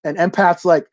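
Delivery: normal talking voice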